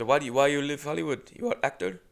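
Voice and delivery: lightly put-on Indian accent, curious; Indian accent impression. Motel clerk quizzing the comic, lightly Indian-accented. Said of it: "I guess I shouldn't be doing this voice".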